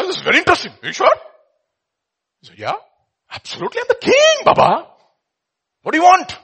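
high, loud, boastful king voice; mock grand emperor. Emperor boasting to the beggar, high and showy. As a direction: play a swaggering emperor showing off.

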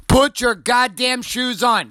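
slow, high-pitched exasperated yell; exasperated parent. Retold or imitated. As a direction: stretch out each word, at the end of your patience. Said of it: "After six I ams, I'm like".

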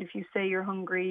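ordinary delivery

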